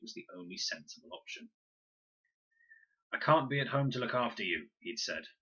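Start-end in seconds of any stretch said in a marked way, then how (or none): 3.1-4.7 father's voice. A narrator's reading.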